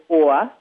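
"or" said with a rounded New York O; New York vowel. Shown as a demonstration. A coach demonstrates the New York short O sound. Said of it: "to a New Yorker is".

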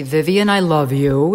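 ordinary talk

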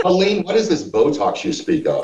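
high, innocent faux-puzzled tone; mock naive voice. Teasing Colleen, feigning ignorance in a high voice.

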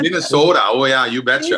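put-on playful Minnesota accent, sing-song you betcha; lightly hammed-up Minnesota folksy accent. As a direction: play along with a mock Minnesota accent. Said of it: "It's a very Canadian accent up there".

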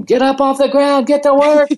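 put-on high, loud yell at the kids; shouting at lazy players; retold yell. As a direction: bark orders across the field. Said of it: "I'm yelling at them".

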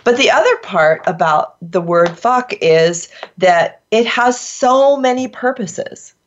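ordinary talk, nothing marked

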